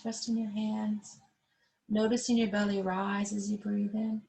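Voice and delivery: calm, unhurried yoga instructor cueing the breath; guided breathing. Acted. Yoga teacher guiding seated class through belly breathing, calm guided voice.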